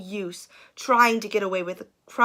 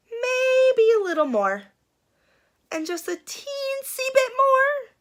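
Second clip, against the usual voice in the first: high, sneaky little voice; sly nibbling. Acted. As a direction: tiptoe the words up high and sneaky. Sheriff nibbling donut, sly.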